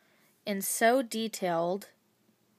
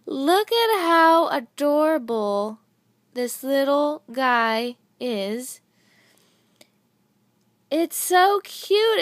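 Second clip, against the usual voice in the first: slow, louder and higher, full of delight; gushing; 5 semitones higher, 7 dB louder, slower than usual. Real, not acted. Showing viewers the little bee, slow gushing delight. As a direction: drag each word out in pure adoration.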